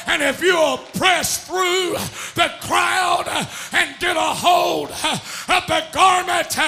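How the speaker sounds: loud, slow, driving revival cadence; full-force preaching. Real, not acted. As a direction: punch out each phrase with a gasp after.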